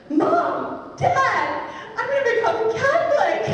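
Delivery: high, loud call across the arrivals hall; excited calling out. Retold or imitated. Calling to her parents in the distance at the airport, high excited shout. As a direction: shout the big news to mom and dad far away.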